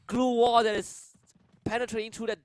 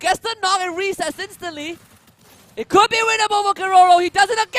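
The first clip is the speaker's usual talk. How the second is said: screaming, much higher and louder; hype shout; 12 semitones higher, 12 dB louder than usual. Real, not acted. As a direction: erupt over the clutch. Clutch play, caster erupts.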